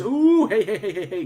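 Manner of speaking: high, loud squeal of hey, then settling; high-pitched giddy ooh-hey, then quick repeated heys; excited chat greeting. Pretend. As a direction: pop in like a giddy fan shouting hello. Reading an excited chat message.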